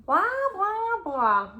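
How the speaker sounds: high dismissive blah blah; sing-song mock reply from the bad eggs. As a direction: brush him off like bratty kids. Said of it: "they replied".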